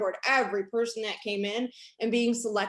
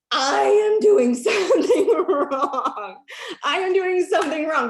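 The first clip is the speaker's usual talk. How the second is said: rueful laugh, louder and higher, slowed; laughing at herself; 7 semitones higher, 8 dB louder than usual. Retold or imitated. Telling a network marketing audience her past self, laughing. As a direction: laugh it out at your own mistake. Realizing her own failure.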